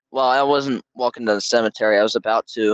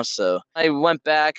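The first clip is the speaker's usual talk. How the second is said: usual speaking voice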